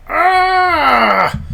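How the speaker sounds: high, drawn-out scream of rage; enraged scream. Acted. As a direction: let out a long high battle scream. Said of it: "she just screams in rage".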